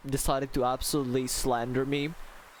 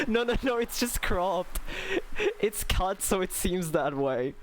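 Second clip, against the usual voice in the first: ordinary talk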